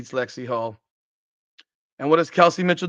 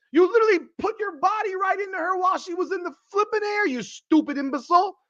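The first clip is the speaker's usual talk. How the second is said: exasperation climbing into a very high-pitched yell; furious rant; 12 semitones higher than usual. Real, not acted. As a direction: tear into the players, voice rising to a scream. Rant at players' foul builds up.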